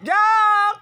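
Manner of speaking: loud high call to the dog. Real, not acted. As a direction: shout the dog's name across the room. Calling the dog.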